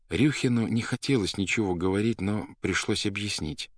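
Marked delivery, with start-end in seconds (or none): none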